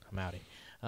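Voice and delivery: soft, unvoiced whisper; playful whisper. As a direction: whisper it under your breath. Joins the whispered joke.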